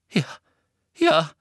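breathless yes; panting. A narrator's reading. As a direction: gasp it out. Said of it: "keuchte Hermine".

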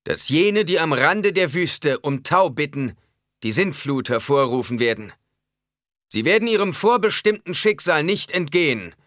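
loud, high-pitched prophecy of doom; thundering. A narrator's reading. Preacher foretells the flood to the crowd, thundering. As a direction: thunder the warning over the crowd. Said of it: "donnerte er".

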